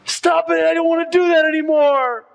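put-on a boy begging the voices in his head to stop; boy pleading with voices. Preacher voicing a tormented boy: high pleading cry. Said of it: "He's talking to the voices in his head".

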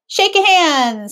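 put-on child answer. A narrator's reading. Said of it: "said Nigel".

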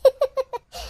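high tittering laugh; short high-pitched titter; amused giggle. Acted. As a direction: giggle at the child's cheek. Grandmother amused by child's request.

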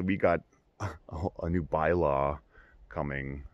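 ordinary delivery

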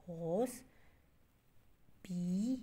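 हॉस and पी as separate beats with long pauses; syllable by syllable. Shown as a demonstration. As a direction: call out हॉस, then पी, each one stretched. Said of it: "break करके आप बोल रहे हैं".

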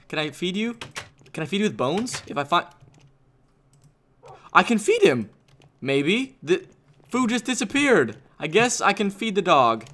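usual speaking voice

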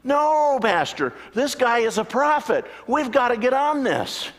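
high, loud indignant pleading; hammed-up outraged churchgoer; upset excited congregant. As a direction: protest in high agitation. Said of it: "he was upset with me".

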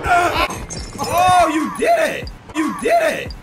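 high, loud, drawn-out yell; startled shout. Real, not acted. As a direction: scream it high in surprise. Replay of startled game reaction.

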